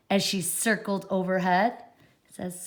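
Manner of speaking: ordinary delivery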